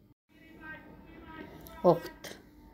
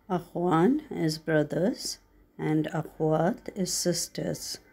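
ordinary talk

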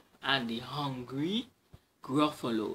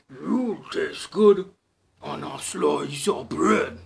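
slow, loud, booming monster voice; a hungry beast savouring its words slowly; Gruffalo voice; 10 dB louder, slower than usual. Acted. Gruffalo threatens to eat the mouse, slow booming growl.

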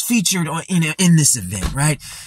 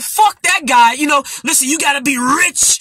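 wrestling promo impression; 7 semitones higher than usual. Mocking Wes cutting off the panel, hammed-up promo shout. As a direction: ham up a knockoff wrestling promo. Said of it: "a knockoff Scott Steiner promo".

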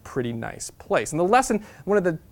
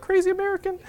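mocking German passengers. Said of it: "the Germans are like".

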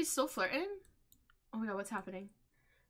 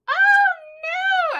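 very high, loud, drawn-out wail; alarmed cry; 12 dB louder than usual. Real, not acted. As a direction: shriek it out in dismay. Alarmed as characters get caught.

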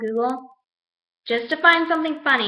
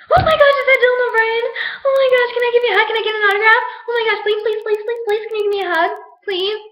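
much higher, much louder, fast gushing shrieks; excited fangirl squeal; 10 semitones higher, 8 dB louder, faster than usual. Acted. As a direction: beg a celebrity for a hug at full squeal. Mistaking stranger for celebrity crush.

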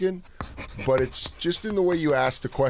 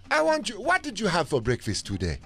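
put-on African accent; 6 semitones higher than usual. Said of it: "start with the African voice".

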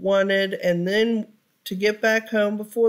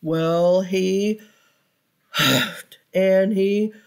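ordinary delivery, nothing marked

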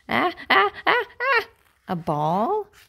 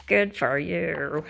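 ordinary delivery, nothing marked

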